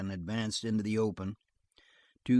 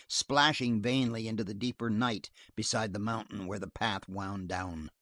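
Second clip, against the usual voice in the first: usual speaking voice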